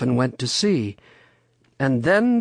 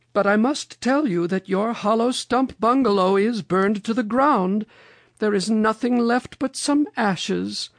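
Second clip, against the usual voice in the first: high, grave storybook lady; Mother Goose; 9 semitones higher than usual. A narrator's reading. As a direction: deliver bad news in a very high voice. Mother Goose breaks the news.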